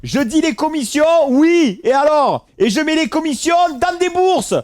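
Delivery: hammed-up so-what challenge; mock outrage, daring them to object; defiant comeback.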